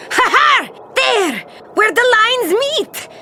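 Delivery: captain exulting over the treasure map; triumphant discovery. Acted. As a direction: crow with delight at the map. Captain finds the treasure spot.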